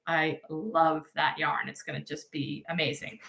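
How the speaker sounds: normal talking voice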